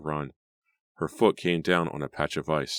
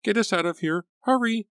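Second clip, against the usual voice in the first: high, loud terrified cry; 4 dB louder than usual. A narrator's reading. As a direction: cry it out, teeth chattering. Grace terrified in car.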